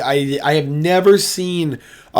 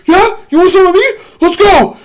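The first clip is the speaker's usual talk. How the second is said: much higher and louder mock taunt; taunting yell; 14 semitones higher, 7 dB louder than usual. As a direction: yell it like daring him to come. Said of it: "He's like".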